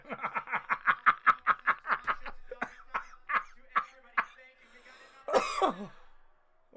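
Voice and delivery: big loud burst of laughter. Real, not acted. Big laugh after joke.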